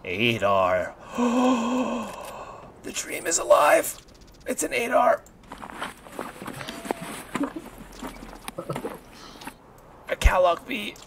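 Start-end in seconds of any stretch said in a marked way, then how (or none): 1.0-2.8 excited gasp. Real, not acted. Finds a rare rifle. 2.8-11.0 excited. Real, not acted. Finds a rare rifle.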